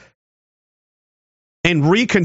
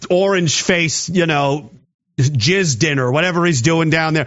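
normal talking voice

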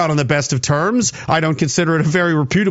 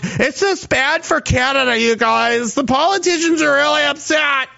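mock whiny citizen; 6 semitones higher than usual. Mocking concerned citizen.

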